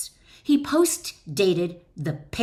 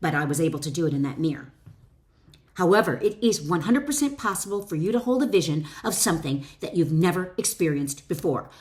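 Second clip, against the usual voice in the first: ordinary talk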